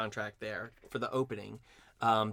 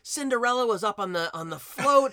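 much higher and louder, starstruck; gushing excitement; 10 semitones higher, 5 dB louder than usual. Retold or imitated. Retelling a coworker's thrill at Cinderella's wink, imitating her giddy gushing. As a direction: squeal it like she just saw Cinderella.

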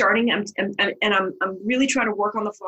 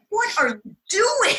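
conductor's shocked look; 12 semitones higher than usual. Retold or imitated. Conductor staring at the lost actress, incredulous shout. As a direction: give her a wide-eyed what on earth. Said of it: "he is looking at me like".

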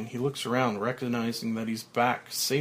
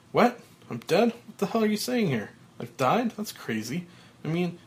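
shocked disbelief; 6 semitones higher than usual. Acted. Frank disbelieving at own grave.

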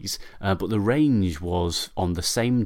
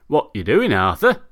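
neighbour calling out; 8 dB louder than usual. Acted. Neighbour on his way home calls to Arthur, voiced louder. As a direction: hail the man on the path with curiosity.

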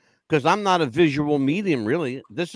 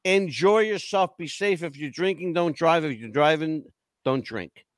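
normal talking voice

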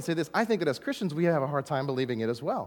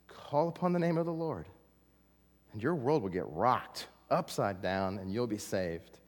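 ordinary talk, nothing marked

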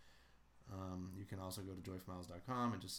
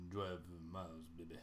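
[name] impression; 4 semitones lower, 4 dB quieter than usual. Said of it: "I'll do an [name]".

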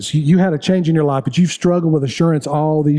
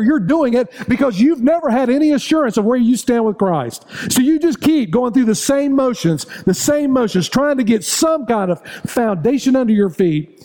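higher, fast, driving sermon build; building preaching; 7 semitones higher than usual. Acted. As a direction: stack the list and drive it home. Preaching at full force.

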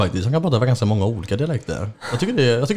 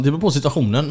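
ordinary talk

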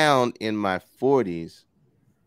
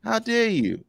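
mock indignation; 12 semitones higher than usual. Playful mock offence.

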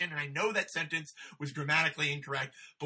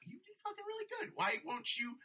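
much softer, higher, fast whisper-like urging; hushed urging; 13 semitones higher, 10 dB quieter than usual. Retold or imitated. Speaker urging proud comic quietly, a hushed high plea. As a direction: urge him under your breath.

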